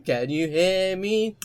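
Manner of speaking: slow, drawn-out question at normal pitch; toned-down call. Acted. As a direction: stretch it out as a mock plea.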